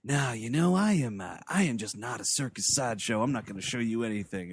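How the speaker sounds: understated low-voiced refusal; quiet refusal. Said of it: "he's like".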